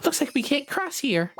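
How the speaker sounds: high character voice. Acted. Voicing a game character.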